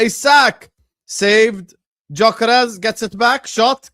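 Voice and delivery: shouting each touch, high and loud; rising goalmouth frenzy; excited commentary. Real, not acted. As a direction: call the chance like a live commentator. Live goal chance commentary.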